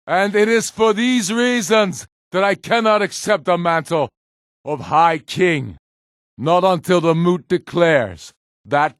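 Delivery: high, loud oration to a crowd; rousing public speech. Acted. Ulfric rallies his people in the square, booming oration. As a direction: proclaim it to the gathered people.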